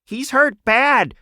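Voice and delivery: high, loud and slow desperate plea; shrieking rat. A narrator's reading. Frightened rat carrying wounded friend, shrieking plea. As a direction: cry out for help. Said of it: "shrieked the bigger rat".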